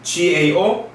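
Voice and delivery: ordinary talk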